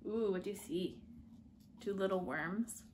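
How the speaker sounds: plain reading voice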